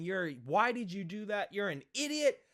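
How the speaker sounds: mildly acted scolding, rising in pitch; mock scream. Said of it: "her just screaming at me".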